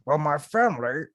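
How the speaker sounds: a hint of a wrestler's put-on voice; light mock Goldberg voice; Goldberg impression. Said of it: "Goldberg talks like this".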